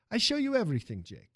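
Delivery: put-on Italian accent. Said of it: "the music of the man's accent".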